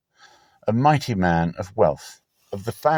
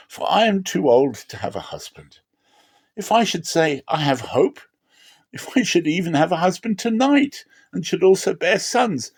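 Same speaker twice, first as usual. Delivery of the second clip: put-on higher, pleading old mother; grieving Naomi; 6 semitones higher than usual. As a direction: urge them away with heavy sorrow. Naomi voiced in the reading.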